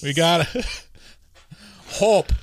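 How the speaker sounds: bad Chicago accent, breaking into laughter; badly faked Chicago accent; Obama with Chicago accent. Host imagines a president talking, Chicago accent. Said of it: "I'm not very good at Chicago accent".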